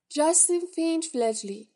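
full name called out high and loud; calling out a name. A narrator's reading. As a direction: announce the student across the hall.

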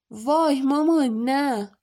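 dismayed, dragged-out whine; whining protest. A narrator's reading. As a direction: plead like a put-upon kid. Son protests his mother's order.

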